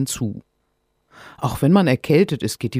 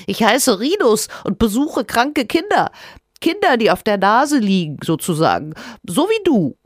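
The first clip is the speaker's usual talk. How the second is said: cheeky little creature; 9 semitones higher, 4 dB louder than usual. Acted. Creature Rinus talking.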